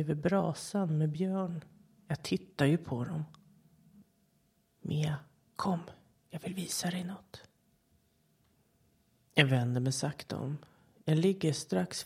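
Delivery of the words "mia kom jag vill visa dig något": soft toneless whisper; coaxing whisper. A narrator's reading. The thing with Micke's voice tempting Mia, whispered. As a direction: draw her in gently.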